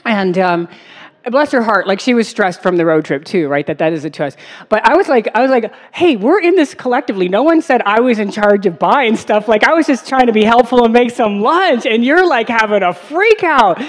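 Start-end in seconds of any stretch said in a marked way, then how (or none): 5.9-13.8 higher-pitched, defensive rant; indignant protest. Retold or imitated. Sandwich maker defending herself to stressed travel companion, indignant. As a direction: push back, acting out your own indignation. Retold defence in lunch quarrel.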